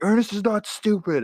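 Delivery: put-on high, loud childish protest; protesting kid. Brother defending his movie to sister, loud childish protest. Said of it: "And I'm like".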